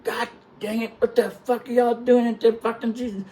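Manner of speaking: high, strained exasperation without extra volume; retold exasperated doc. Doc scolds the men brought in after shock, exasperated. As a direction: act out an appalled scolding.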